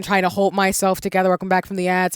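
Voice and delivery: ordinary talk, nothing marked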